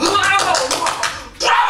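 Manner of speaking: high, full-volume roar of support; wild cheering yell. Shown as a demonstration. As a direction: scream out a bravo like a frenzied fan. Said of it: "막 이렇게 막". (열광적으로 내지르는 응원 함성)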